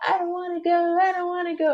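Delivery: put-on high-pitched toddler tantrum whine; whining child. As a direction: whine it like a kid refusing to leave. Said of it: "like a child, just whining".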